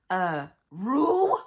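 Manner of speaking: loud anguished yell; real upset yell at the book. Real, not acted. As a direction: cry out the name in disbelief. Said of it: "I didn't mean to yell".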